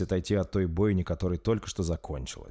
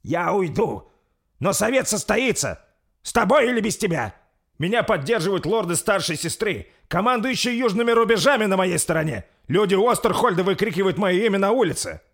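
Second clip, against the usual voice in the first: loud, high, proud declaration; haughty; 12 semitones higher, 8 dB louder than usual. A narrator's reading. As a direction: boast of your backing from above. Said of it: "надменно произнёс принц". (надменно, повысив голос)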